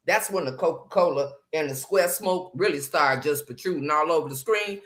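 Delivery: ordinary delivery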